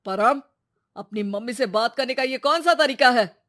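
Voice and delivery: loud, stern reprimand; stern father raising his voice; scolding father. Acted. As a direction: tell the son off forcefully.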